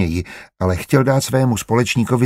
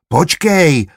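higher-pitched mild shout; calling out; 6 semitones higher, 4 dB louder than usual. A narrator's reading. Lukáš calling after his departing partner, raised voice. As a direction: call after someone who is leaving. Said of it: "křikl za ním Lukáš".

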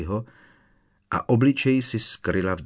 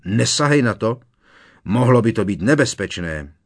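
loud, urgent command; roared warning; 3 semitones higher, 7 dB louder than usual. A narrator's reading. Strýc Vernon stops Petunie touching the letter, roaring warning. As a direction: shout the warning, louder and urgent. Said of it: "zařval strýc Vernon".

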